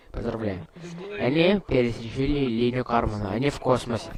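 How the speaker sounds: normal talking voice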